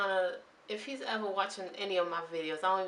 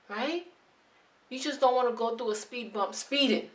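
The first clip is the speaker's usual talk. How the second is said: normal talking voice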